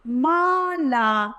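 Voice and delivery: Mala stretched slowly, syllable by syllable; slow syllable reading. Shown as a demonstration. Teacher sounding out Mala for children. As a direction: spell out Mala slowly for pupils. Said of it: "हमारा शब्द बनेगा".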